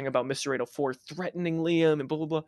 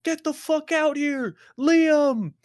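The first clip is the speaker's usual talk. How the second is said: high, loud call-out across the driveway; retold yelling; 12 semitones higher, 5 dB louder, much slower than usual. Drug dealer with a bat calling Liam out of the house, loud high yell. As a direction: bellow his name to drag him outside.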